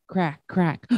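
ordinary delivery, nothing marked

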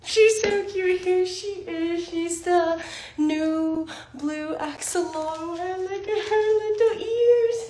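high, slow, drawn-out cooing; sing-song high gushing; adoring coo. Real, not acted. Cooing over the cute plush.

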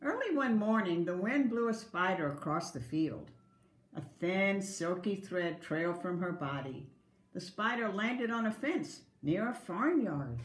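gentle, clear read-aloud storytelling; story narration. A narrator's reading. Teacher reading a picture book to kindergarten, gentle storytelling.

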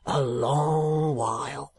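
slow rough-spoken keeper, lightly voiced; a plain working man, unhurried; lightly voiced asylum keeper. Keeper answers.

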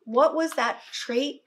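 usual speaking voice

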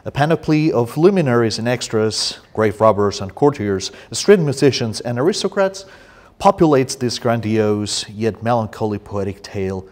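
emphatic, declaimed introduction; formal reading aloud. Acted. Poet reads book introduction to audience, emphatic formal reading.

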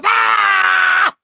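a sudden high, loud scream; piercing open-mouthed scream. Real, not acted. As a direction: let out a full-throated "Ah!". Screams after build-up.

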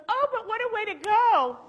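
high, exultant mock cry.

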